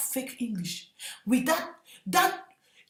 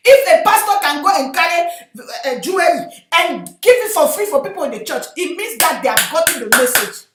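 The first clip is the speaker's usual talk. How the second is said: loud, emphatic sermon delivery; preacher raising the voice; forceful preaching; 3 semitones higher, 11 dB louder than usual. Real, not acted. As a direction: drive the point home loudly from the pulpit.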